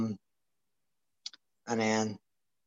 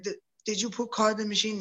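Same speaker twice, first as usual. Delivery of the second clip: high, softer take on a Russian coach; retold coach, pitched well up; 10 semitones higher, 3 dB quieter than usual. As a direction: imitate the coach in a raised, quiet voice. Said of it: "Czar, speaks Russian".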